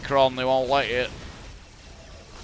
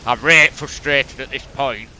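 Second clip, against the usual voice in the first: broad Yorkshire, "right" for really, louder; full Yorkshire; 3 semitones higher, 8 dB louder than usual. Shown as a demonstration. Gamer repeating his frustration in full Yorkshire, louder. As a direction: lean hard into the Yorkshire dialect. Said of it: "Sorry, full Yorkshire".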